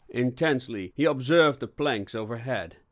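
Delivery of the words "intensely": ordinary delivery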